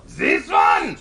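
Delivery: high, loud French cartoon villain. Villain pointing out the rock to the rabbit, loud mock French voice. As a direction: shout it in a mock French accent. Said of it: "so Jacques keeps upping the dam".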